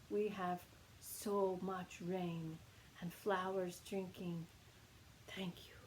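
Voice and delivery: slow, low, hushed reverence; soft and grave, fading almost to a whisper; very solemn. Retold or imitated. Korean teacher thanking students for desert rain, very solemn. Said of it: "He's being very solemn".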